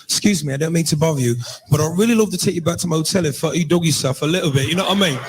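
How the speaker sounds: put-on smooth pickup line in an English accent. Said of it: "English accent, that just roll off the tongue different".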